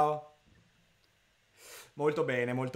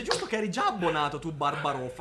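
ordinary talk, nothing marked

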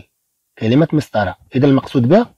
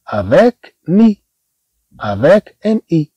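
avec mi stretched very slowly, pitch raised; slow teaching demo; 4 semitones higher, much slower than usual. Shown as a demonstration. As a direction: sound it out slowly for learners. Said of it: "on a des mots".

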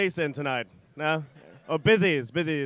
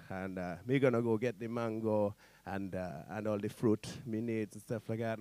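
lower, easy-going Jamaican voice; Jamaican accent; 6 semitones lower, 6 dB quieter than usual. Acted. Rasta chef saying what he'll cook, Jamaican accent. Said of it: "it's set in Jamaica".

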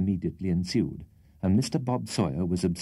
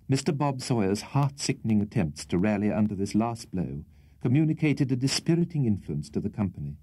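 ordinary delivery, nothing marked

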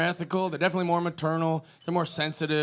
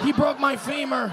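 whiny injured guy; 9 semitones higher than usual. Retold or imitated. Injured man whining in mock game.

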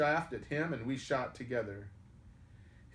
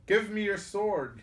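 high, loud demand; urgent cry; 9 semitones higher, 6 dB louder than usual. A narrator's reading. Little John calls to his wounded master for the sword, crying out. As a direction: cry it out, raised in pitch and volume.

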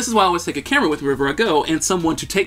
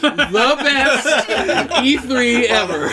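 laughing joy; 9 semitones higher, 4 dB louder, much slower than usual. Real, not acted. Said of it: "climax of story".

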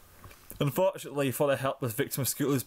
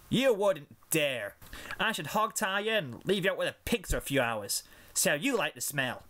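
threatening; 5 semitones higher than usual. Acted. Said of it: "Applebloom threatened".

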